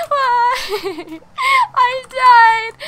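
high, loud half-sob; laugh-crying. Real, not acted. Gamer laugh-crying after dying, loud and high. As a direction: laugh and cry at once.

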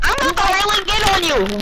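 high-pitched mocking echo; mocking imitation. Mimics opponent's threat back at him.

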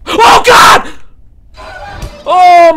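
startled scream of fright; frightened shout. Real, not acted. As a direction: yelp as the granny appears. Horror game jump scare.

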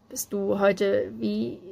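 slow, halting attempt at a question; hesitant start. A narrator's reading. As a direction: begin to ask, then falter. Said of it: "setzte er an".

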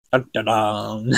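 slow, ominous "dun dun dun"; mock suspense sting. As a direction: intone a hammed-up cliffhanger sting. Mock suspense sting after the chapter.

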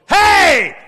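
sudden high, loud yell; startling wake-up shout. Acted. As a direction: jolt a sleeper awake. Acts waking a sleeper.

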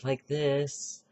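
up high, thin head-voice sound; high head voice demo. Shown as a demonstration. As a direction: show the wrong, high way. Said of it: "instead of making it go the long way around".

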